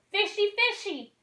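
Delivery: put-on high, slow sing-song little girl; gleeful child. Darla swinging the bag, a high sing-song child. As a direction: taunt the fish playfully.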